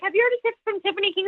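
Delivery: high, fast mock gossip voice; gossiping LA comics. Mocking the LA comics, high and fast gossipy voice. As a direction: play the LA comics passing around the rumor. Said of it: "Everybody in L A is probably like".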